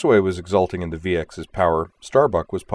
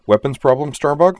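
higher, louder worried question; concerned; 8 semitones higher, 7 dB louder than usual. A narrator's reading. Boomer checks on a wingmate in combat, concerned. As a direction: lift it urgently into an anxious question. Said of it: "asked with concern in her voice".

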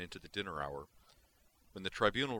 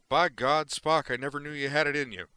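much louder and higher, bursting with glee; delighted exclamation; 8 semitones higher, 12 dB louder than usual. Acted. McCoy praising Spock's speech, delighted and loud. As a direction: crow it out in delight. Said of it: "McCoy said with a delighted grin".

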